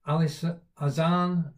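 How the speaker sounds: ordinary delivery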